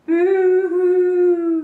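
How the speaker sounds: high, loud, drawn-out mock wail; hammed-up crying. Gecko who lost his tail, wailing in mock tears. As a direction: wail it like a cartoon crybaby. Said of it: "he cried".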